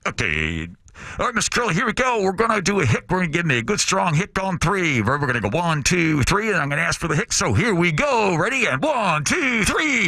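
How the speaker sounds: high, excited pilot counting down; hyped pilot coach voice. Retold or imitated. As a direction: pump up the passenger like a pilot. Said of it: "He's like".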